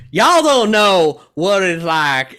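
loud, slow, high Texas twang; Texas accent. Acted. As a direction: drawl it in a hammed-up Texas accent. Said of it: "accent sounds like".

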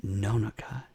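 slow whispered secret; conspiratorial whisper. Retold or imitated. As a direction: lean in and whisper it slowly. Character tells a secret.

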